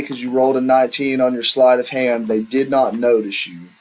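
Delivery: normal talking voice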